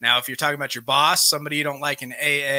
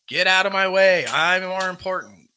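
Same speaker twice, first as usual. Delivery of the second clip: higher, mock impatient outburst; acted impatience; 5 semitones higher, 4 dB louder than usual. Speaker voicing someone who has to get past, acted impatience. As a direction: put on pushy, self-important annoyance. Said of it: "impatience, lack of patience, annoyance".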